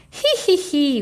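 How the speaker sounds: high, loud wheezy hee hee; a tickled dragon wheezing with laughter; wheezing giggle. A narrator's reading. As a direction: giggle helplessly, out of breath. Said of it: "wheezed the dragon".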